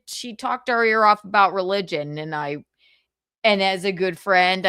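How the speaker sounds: ordinary talk, nothing marked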